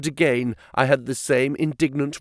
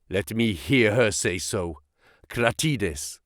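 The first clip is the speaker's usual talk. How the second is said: low, guarded prisoner voice; defiant captive; 5 semitones lower, 3 dB quieter, slower than usual. A narrator's reading. Captive answering his interrogator, low and defiant. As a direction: answer in a low, deliberate register.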